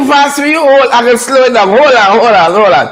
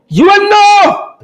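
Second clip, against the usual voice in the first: mock preacher call; 6 semitones higher, slower than usual. Pastor-style comic warning to viewers, drawn out and high. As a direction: belt it slow like a preacher warning the congregation.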